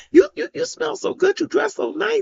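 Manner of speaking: high, sing-song mock of the doctor. Mother mimicking doctors who doubted her pain, mocking. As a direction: parrot their dismissive words.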